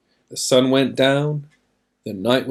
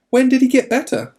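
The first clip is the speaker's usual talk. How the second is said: high, loud eager question; 11 semitones higher, 4 dB louder, faster than usual. Acted. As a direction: grab at the news. Father eagerly asks servants.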